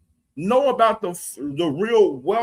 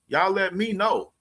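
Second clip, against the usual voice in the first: ordinary talk, nothing marked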